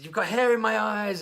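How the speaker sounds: high, loud mock yell; complaining child. Child protests to hairdresser mid-haircut, loud mock complaint. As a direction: imitate an over-dramatic kid's protest.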